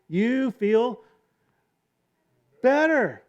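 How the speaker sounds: you feel better flung upward; rising exclaim. Acted. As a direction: land better like a shout of relief. Refrain, lifted high.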